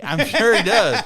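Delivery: loud, high emphatic agreement. Real, not acted. Co-host teases about dad's motives, loud knowing agreement. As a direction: agree loudly over the laughter.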